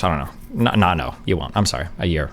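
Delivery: quiet aside. Real, not acted. Streamer quietly correcting his own estimate. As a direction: mutter it to yourself.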